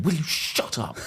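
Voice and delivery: imitating a snapped shut up; mock outburst. Driver imagining snapping at passengers, mock yell. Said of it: "turning around and going".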